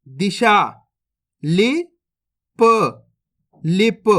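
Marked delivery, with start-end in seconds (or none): 1.4-2.6 लिप stretched very slowly, sounds held long; slow teaching demo; drawn out slowly. Shown as a demonstration. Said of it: "अब चलिए आगे देखिए".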